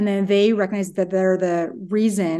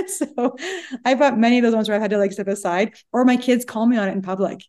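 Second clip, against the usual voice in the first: ordinary talk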